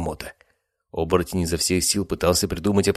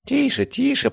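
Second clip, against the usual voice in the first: much higher, sing-song hush; mocking villain. A narrator's reading. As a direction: taunt him like a child. Villain mocks captive. (насмешливо, нараспев)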